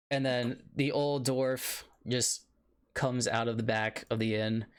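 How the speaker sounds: normal talking voice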